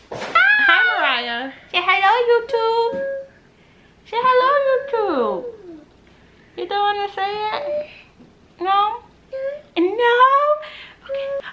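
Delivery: very high, sing-song coaxing voice. Real, not acted. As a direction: coax a little one sweetly, way up high. Mother coaxes small child to greet viewers.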